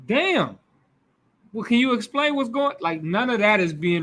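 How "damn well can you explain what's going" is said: put-on confused boy. Confused boy questions father.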